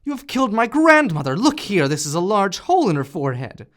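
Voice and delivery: loud, high outraged accusation; accusing shout. A narrator's reading. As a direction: blame him at the top of your voice. Klaus accuses landlord of killing.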